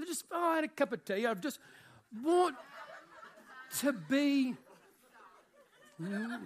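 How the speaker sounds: put-on a preacher failing at a posh English accent, cracking up; mock English accent. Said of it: "have a horrible English accent".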